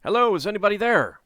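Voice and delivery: a loud hello at a closed door; shouted call. Acted. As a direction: call out to whoever's inside. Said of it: "he shouted".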